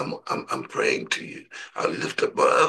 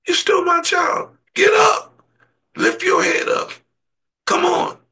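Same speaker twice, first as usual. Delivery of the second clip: high, loud calling shout, crying out; God calling out; 7 dB louder than usual. Acted. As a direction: lift them up with a booming cry. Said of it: "Lord said".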